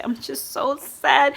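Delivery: imitating someone wallowing in sadness, high and slow; mock sad. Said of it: "and they'll say".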